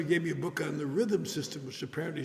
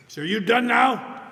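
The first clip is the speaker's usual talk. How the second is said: mock stern question; 7 semitones higher, 11 dB louder, slower than usual. Bob pressing him, retold.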